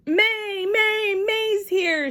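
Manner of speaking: high excited shout through a window. A narrator's reading. May shouting her arrival from the car. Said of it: "she shouted through the open window".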